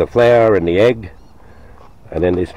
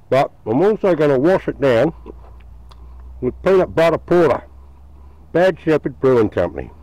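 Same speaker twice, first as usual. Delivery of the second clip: talking through a mouthful of food; chewing while he talks, mouth full; 5 semitones higher than usual. Real, not acted. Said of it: "I'm talking with my mouth full".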